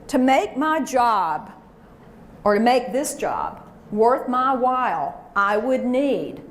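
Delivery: slow, measured phrase; slow deliberate. Shown as a demonstration. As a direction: say it slowly and firmly. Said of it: "The second thing that you could say".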